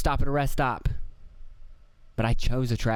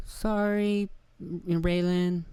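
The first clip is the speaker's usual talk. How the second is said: put-on high little-kid voice, slow on the name; imitating toddler son; 8 semitones higher, much slower than usual. Three-year-old son apologizing to his sister, high toddler voice. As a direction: mimic a three-year-old's sweet sorry. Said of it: "he'll just go".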